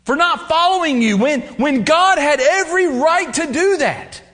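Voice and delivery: loud, high, rising sermon voice; fiery preaching. Acted. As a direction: preach it with growing fire. Preaching at full force.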